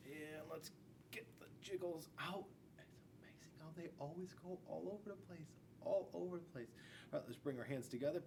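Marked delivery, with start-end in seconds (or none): 0.1-6.7 hushed, breathy giggly whisper; playful whisper. Acted. Teacher whispering to kids while they wiggle. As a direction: whisper it like a secret fun fact. Teacher gets kids' jiggles out.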